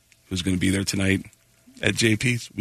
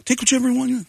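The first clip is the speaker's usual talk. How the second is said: high, eager host voice; generous offer; 13 semitones higher, faster than usual. Retold or imitated. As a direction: push the drugs on him cheerfully. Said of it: "I go".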